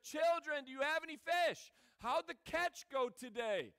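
called out loud and high to the boat; loud call across the water. Retold or imitated. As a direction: shout it to men far out in a boat. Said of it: "he's like".

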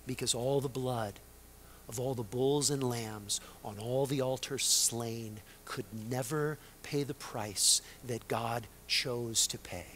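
low, soft, weighty preaching; hushed solemnity. Real, not acted. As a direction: drop low and soft, let the words settle.

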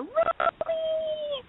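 high, slow call across a club. Retold or imitated. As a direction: call a name from afar. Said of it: "I hear".

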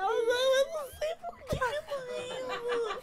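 imitated high squeal of helpless laughter; drawn-out, very high giggly whine; laughing helplessly. Wife unable to say why she's laughing, high mock giggling wail. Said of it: "você tá meio rindo".

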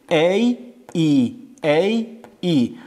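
"ای" alternately as "ey" and "ee", slowly; alternating vowel drill. Shown as a demonstration. Teacher drills fifth and sixth alef, slow "ey, ee" on "ای". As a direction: draw out "ای": first gliding "ey", then pure "ee".

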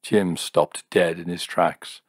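ordinary delivery, nothing marked

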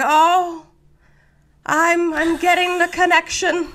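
high, drawn-out mock seance voice; hammed-up medium. Wife channeling at her seance, hammed-up high medium voice. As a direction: put on a theatrical medium's trance. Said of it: "she does the whole thing".

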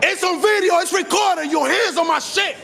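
high, indignant yelling; angry outburst. Real, not acted. Furious about attempted camera grab.

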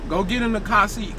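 ordinary talk

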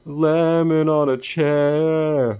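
high, slow amazed exclamation; surprised exclaim. Real, not acted. Gamer spots a lemon on a chair, high and slow in surprise. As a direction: gasp at the lemon in the hallway, drawn out. Gamer reacting to odd sight.

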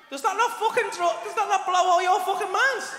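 hyped-up disbelief at full volume; incredulous shout. Acted. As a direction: yell it as if they must be astounded. Demanding awe from the audience.

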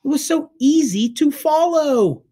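high, loud exasperated complaint; fed up with his friend's mistake. A narrator's reading. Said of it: "Matt suddenly sounded exasperated".

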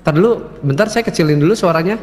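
very loud voice. Real, not acted. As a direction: speak up too loudly. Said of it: "gede banget ya suaranya". (suara keras)

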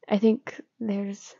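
plain reading voice

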